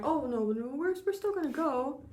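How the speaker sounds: sheepish backpedal. Retold or imitated. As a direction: wriggle out of a promise sheepishly. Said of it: "and then like".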